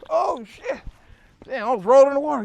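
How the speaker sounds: high, loud startled yelling; mock panic. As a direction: play a sudden scramble from gunfire. Acted reaction to gunfire.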